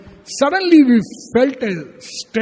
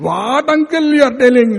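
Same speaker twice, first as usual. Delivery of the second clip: higher, incredulous retort; laughing dismissal, imitated; 5 semitones higher, 4 dB louder than usual. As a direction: imitate a boy scoffing at his elder. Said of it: "He laughed at him".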